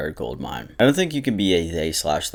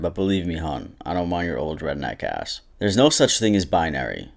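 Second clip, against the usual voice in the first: ordinary talk, nothing marked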